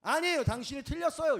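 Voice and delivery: acted indignant rebuttal; blunt contradiction. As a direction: object sharply, raising the voice.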